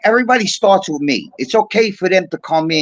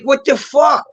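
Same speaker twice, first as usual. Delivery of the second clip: higher-pitched indignant outburst; baffled protest; 7 semitones higher, slower than usual. Retold or imitated. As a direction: retell it as an exasperated reaction. Retelling his baffled reaction.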